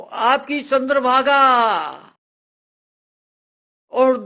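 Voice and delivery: high, loud, long-drawn cry for help; slow, high-pitched shouted summons, fading at the very end; shouting for help. Acted. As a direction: call urgently across a distance, stretching each phrase. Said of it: "तो सांपू चिल्लाती है".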